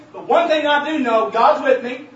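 loud, fervent sermon climax; preaching at full force. Real, not acted. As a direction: drive it home to the congregation.

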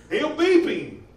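ordinary talk

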